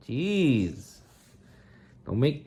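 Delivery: ordinary delivery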